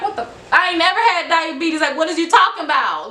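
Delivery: high-pitched indignant protest; retold disbelief. Patient answering the lady about diabetes, retold indignant disbelief. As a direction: imitate an incredulous comeback, pitched well up.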